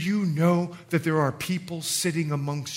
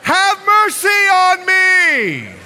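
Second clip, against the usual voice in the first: high, loud, long-drawn cry; desperate shout; 11 semitones higher, 8 dB louder, much slower than usual. Acted. Beggar desperate to be heard by Jesus, desperate shout. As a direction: bellow the plea over all the noise. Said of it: "this guy is desperate to overcome".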